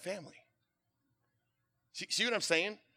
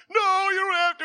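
pitched way up and louder, pleading; high pleading protest; 9 dB louder than usual. Retold or imitated. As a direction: blurt out a high, loud refusal. Weeping man refuses offered help.

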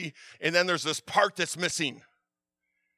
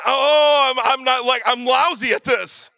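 loud, frustrated self-talk; frustrated outburst; 4 semitones higher, 6 dB louder than usual. Retold or imitated. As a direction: blurt out the frustration at yourself. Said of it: "I'm just feeling".

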